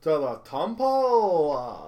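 "To the temple" drawn out very slowly and loud. Pretend. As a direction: spell it out slowly for a foreigner. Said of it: "repeating slowly for captain".

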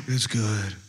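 low, softened, joyless reply; mock glum. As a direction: drop low, as if unconvinced yourself. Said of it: "tell our face that we're happy".

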